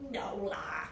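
low, dismissive Scouse "la"; Scouse accent. Acted. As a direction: Liverpool accent, offhand. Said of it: "said the devil".

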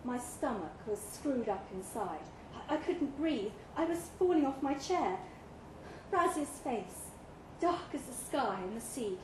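ordinary delivery, nothing marked

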